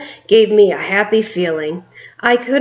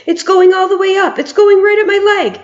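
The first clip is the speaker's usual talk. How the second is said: high, loud, rushed panic; yelling; 11 semitones higher, 4 dB louder, faster than usual. A narrator's reading. Cook yelling as the mouse runs up his leg, high fast panic. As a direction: gabble it out in terror. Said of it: "the man was yelling".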